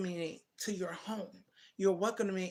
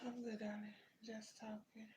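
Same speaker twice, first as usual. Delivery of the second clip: whisper; 16 dB quieter than usual. Real, not acted. Aside to someone off camera.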